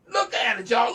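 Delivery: insistent but held back; worked up. Real, not acted. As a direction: push them to look, somewhat loud. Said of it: "I'm getting worked up here".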